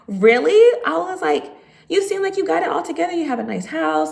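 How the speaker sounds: a high, incredulous recounting of one's own reaction; retold disbelief. Voices her surprised reply to appraiser.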